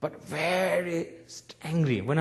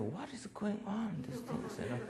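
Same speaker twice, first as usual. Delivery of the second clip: put-on bewildered child; 5 semitones higher, 8 dB quieter than usual. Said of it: "and I said".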